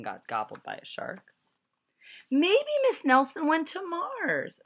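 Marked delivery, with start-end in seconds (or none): 2.3-4.6 put-on high, loud child voice; kid's guess. A narrator's reading. Another kid guessing wildly in a high voice. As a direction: pipe up as a young kid. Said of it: "said another kid".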